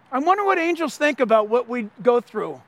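ordinary talk

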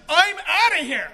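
loud, high, drawn-out exit line; Lazarus. Acted. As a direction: belt it out slowly, eager to leave. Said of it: "Can you imagine Lazarus?".

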